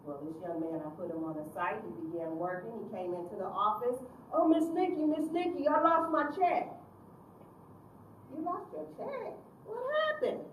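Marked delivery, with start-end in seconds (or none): 4.3-6.7 put-on high, loud pleading teen voice; whiny youth. Teen pleading with his counselor about his lost check, loud and whiny. 8.3-10.4 mock concern.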